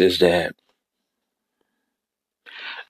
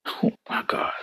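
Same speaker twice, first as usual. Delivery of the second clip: whisper; 10 dB quieter than usual. Real, not acted. Whispered aside during sermon.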